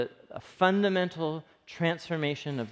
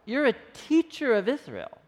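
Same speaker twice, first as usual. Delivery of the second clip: higher, pushy challenge to the teacher; in his face; 6 semitones higher than usual. Retold or imitated. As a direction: get right up in his face. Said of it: "kinda gets in his face".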